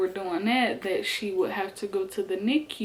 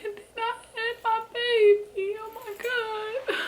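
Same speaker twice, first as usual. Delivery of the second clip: fake crying; 14 semitones higher than usual. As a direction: ham up a tearful crying voice. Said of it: "me trying to fake cry".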